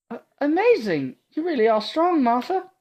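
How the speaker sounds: put-on high, eager girl's voice; awed young heroine praising her protector; timid young girl. Awed Ada gushing at Martha, high and breathless.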